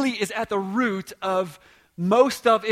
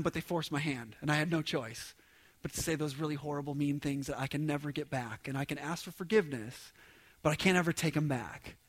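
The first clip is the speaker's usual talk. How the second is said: softer, lower self-excusing tone; subdued, mock-helpless admission; playing the victim; 5 semitones lower, 9 dB quieter than usual. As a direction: drop low and quiet, excusing yourself. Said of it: "we play the victim".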